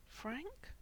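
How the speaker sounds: very soft, high, tentative question; whispered question. A narrator's reading. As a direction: whisper it cautiously, rising at the end. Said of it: "Mikey whispered".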